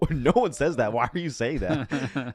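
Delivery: laughing disbelief. Retold or imitated. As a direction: laugh through the words in disbelief. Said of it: "and they're like".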